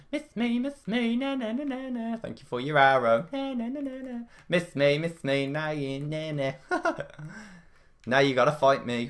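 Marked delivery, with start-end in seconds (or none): 0.1-6.5 high teasing na na chant; taunting sing-song. Acted. Taunting opponent who missed arrows.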